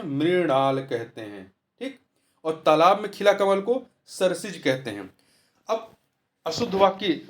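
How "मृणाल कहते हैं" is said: slow answer. Shown as a demonstration. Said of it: "क्या कहते हैं?".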